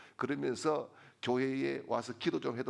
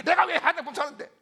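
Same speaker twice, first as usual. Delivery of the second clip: high, loud, rapid-fire challenge; hammed-up furious demand, pitched high; imitated angry outburst; 11 semitones higher, 9 dB louder, faster than usual. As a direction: act out a wife boiling over, fast and shrieked. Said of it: "아내가 화가 막 치밀어 올라가지고".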